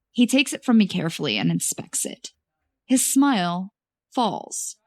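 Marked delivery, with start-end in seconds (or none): none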